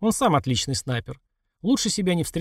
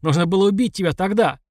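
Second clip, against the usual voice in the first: loud pained yell, voice raised; screaming in pain; 4 semitones higher, 3 dB louder than usual. A narrator's reading. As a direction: shout through the pain, enraged. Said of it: "Он закричал от боли".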